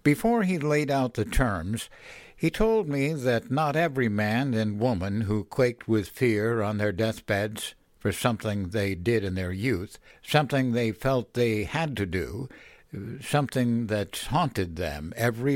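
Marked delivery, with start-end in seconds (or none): none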